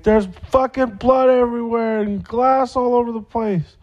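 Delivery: very high, panicked imitation of her; retold frantic scream. Heather seeing the blood and glass, high frantic mock shriek. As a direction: squeal it like she's horrified at the mess. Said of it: "she was kinda screaming frantically".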